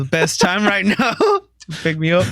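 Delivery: high-pitched joking plea, laughing; mock surrender. Guest telling pretend officers to pick him up, high mock plea.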